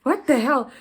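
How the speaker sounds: high, loud disbelief; what the hell, said in shocked outrage; exasperated at the clerk, high and loud; incredulous. Retold or imitated. Exasperated retelling at Home Affairs.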